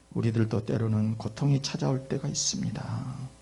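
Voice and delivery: normal talking voice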